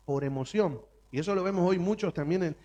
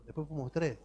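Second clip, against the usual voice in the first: usual speaking voice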